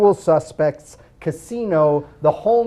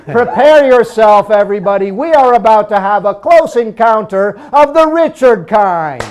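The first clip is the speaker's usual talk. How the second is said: announcer hype; 3 semitones higher, 8 dB louder than usual. Acted. Host's big hyped introduction.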